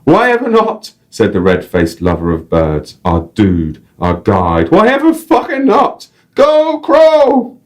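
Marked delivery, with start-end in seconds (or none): 0.0-0.9 put-on high exclaim in a Northern English accent; delighted Northern English rhetorical cry. Guide answering the family, high Northern English accent. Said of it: "random North English accent". 4.7-7.5 high, loud Northern English cheer; exuberant Northern English yell. Acted. Guide cheering the crow, loud Northern English accent. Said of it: "random North English accent".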